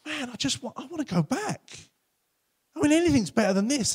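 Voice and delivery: weary believer longing to return to God; longing plea. Acted. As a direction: sigh out a wish to go back. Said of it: "and you're like".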